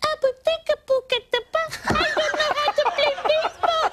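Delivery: mock Julia Roberts helpless at bat; whiny actress. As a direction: Julia Roberts whining she can't play. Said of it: "she's like".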